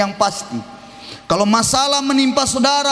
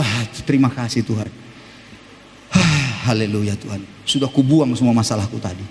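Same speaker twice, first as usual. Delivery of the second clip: relieved calm; 11 semitones lower than usual. Retold or imitated. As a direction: sigh out in relief. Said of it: "Pasti udah". (ucapan lega yang rendah dan lembut)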